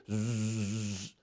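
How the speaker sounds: low, soft, drawn-out buzz; sewing machine hum. Retold or imitated. Lying in bed hearing mom's sewing machine, soft humming buzz. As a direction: imitate a distant machine humming steadily. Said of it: "the hum of the sewing machine going".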